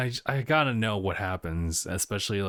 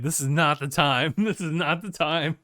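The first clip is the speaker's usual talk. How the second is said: mock panic; 5 semitones higher, 4 dB louder than usual. Acting out frustration during girl's visions.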